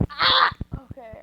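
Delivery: ordinary delivery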